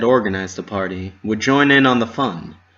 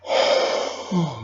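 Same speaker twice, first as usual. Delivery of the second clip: yawning sigh; 5 semitones higher than usual. A narrator's reading.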